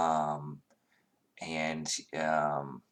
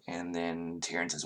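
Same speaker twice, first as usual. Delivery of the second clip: usual speaking voice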